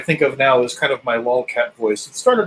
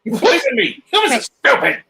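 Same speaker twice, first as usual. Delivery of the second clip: shrieking, outraged cat voice; very high mock yelp of a betrayed pet; comically horrified lolcat outburst; Samson; 3 dB louder than usual. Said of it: "And then Samson would go".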